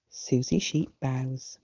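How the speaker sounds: ordinary delivery, nothing marked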